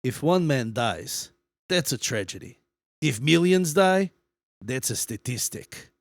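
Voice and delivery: put-on slow, measured Russian-accented delivery. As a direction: quote it slowly as Stalin, Russian accent. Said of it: "should I do it in a Russian accent?".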